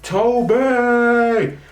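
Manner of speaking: high, excited shout of the name; excited scream. Retold or imitated. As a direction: yell the name like a thrilled fan. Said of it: "I screamed".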